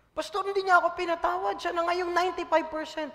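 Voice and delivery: high whining mock complaint to the pastor; whiny church member. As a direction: play the aggrieved member.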